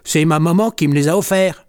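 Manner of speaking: mildly indignant self-defence; defensive. A narrator's reading. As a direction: protest innocence in a lightly voiced kitten tone. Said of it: "se défend Jean Chat".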